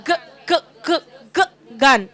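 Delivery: g g g repeated before gun; sounding out g. Shown as a demonstration. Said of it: "What is the sound?".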